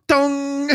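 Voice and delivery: high ringing "dong" imitation; mimicked clang. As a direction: imitate a skillet being struck. Acts out hit on hidden skillet.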